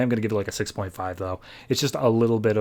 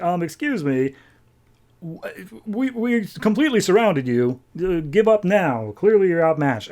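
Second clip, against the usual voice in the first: mock gang tough; 6 semitones higher than usual. Gang member answers the heroes.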